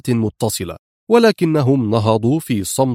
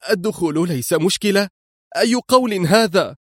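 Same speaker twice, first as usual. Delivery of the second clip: "الدخول ليس مشكلة؟" thrown back high and disbelieving; incredulous echo; 7 semitones higher than usual. Acted. Woman echoes her rescuer in high disbelief. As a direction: repeat his words in high disbelief. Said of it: "هتفت".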